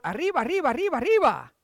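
a hint of the cartoon mouse cry; light Speedy echo. Preacher echoing Peter's urging with a touch of Speedy. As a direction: toss in a lightly voiced arriba. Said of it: "So Peter says".

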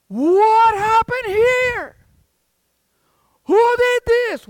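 high, drawn-out, booming shout; outraged dad. Acted. Dad coming home to his smashed god, outraged. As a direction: bellow slowly in acted shock and outrage.

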